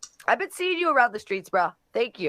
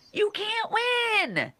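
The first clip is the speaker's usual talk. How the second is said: high taunt; 12 semitones higher, much slower than usual. Real, not acted. Taunting his online haters.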